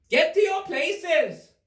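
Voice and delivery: high, slow thundering command. A narrator's reading. Queen starts croquet, thundering command. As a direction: order everyone about. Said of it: "in a voice of thunder".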